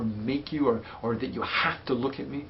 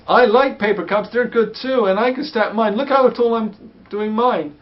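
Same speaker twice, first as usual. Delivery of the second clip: higher and much louder, pushing in; too loud joining; 10 semitones higher, 10 dB louder than usual. Shown as a demonstration. Parent intruding on the child's play, overbearing and loud. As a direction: boom your own cups over the child's. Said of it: "if you're talking about paper cups like this".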